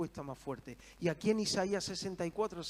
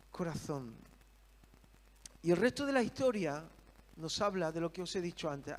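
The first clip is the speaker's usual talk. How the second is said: ordinary talk, nothing marked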